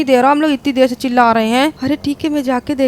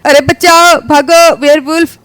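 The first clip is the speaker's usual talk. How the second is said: screaming for help; 2 semitones higher, 7 dB louder, slower than usual. Acted. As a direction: scream to run from the werewolf. (मदद के लिए चीखते हुए)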